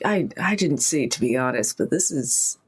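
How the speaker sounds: usual speaking voice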